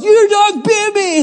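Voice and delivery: high, slow, drawn-out yell; retold yelling. Bitten walker confronting the dog's owner, high drawn-out yell. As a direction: yell it high and stretched, reenacting outrage. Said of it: "I was yelling at this lady".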